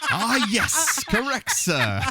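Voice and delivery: laughing, hearty announcer voice; Ed McMahon impression. Host does his Ed McMahon for friends, laughing. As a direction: boom it like a TV sidekick. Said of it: "And now my Ed McMahon".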